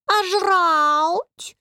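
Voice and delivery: high, drawn-out demand; insistent. A narrator's reading. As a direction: nag like a hungry cat. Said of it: "настойчиво спросил помощник". (настойчиво, высоким протяжным голосом)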